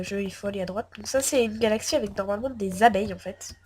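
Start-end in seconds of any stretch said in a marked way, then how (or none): none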